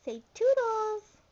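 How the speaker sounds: very high, bright coaxing voice; high sing-song coaxing. Real, not acted. Coaxing toddler to say word.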